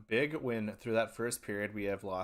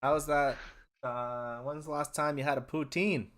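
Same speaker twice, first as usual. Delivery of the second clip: mock Canadian accent; 4 semitones higher than usual. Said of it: "chat in Canadian".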